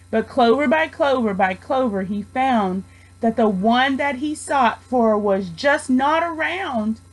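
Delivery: plain reading voice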